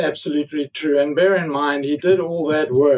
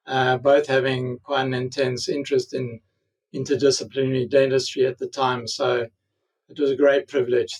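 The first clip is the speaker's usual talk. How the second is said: normal talking voice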